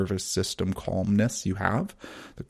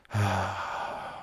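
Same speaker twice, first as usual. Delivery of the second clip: drawn-out soft exhale sigh; long slow sigh; 6 dB quieter than usual. Shown as a demonstration. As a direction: breathe out in one slow sigh. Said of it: "long, slow sigh".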